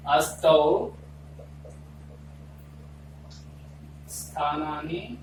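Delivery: shloka recitation. Shown as a demonstration. (धीमे, ठहर-ठहर कर श्लोक पाठ)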